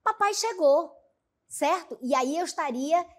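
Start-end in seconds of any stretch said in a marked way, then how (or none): 0.0-0.9 surprised, rising high on papai; "Papai chegou" said with surprise, papai pitched high. Shown as a demonstration. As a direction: say it as if amazed he's home. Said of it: "com um tom de surpresa".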